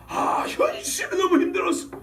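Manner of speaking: hammed-up groan of hardship; mock complaint. Mimics complaint about hard reality. (과장되게 늘여 하는 푸념)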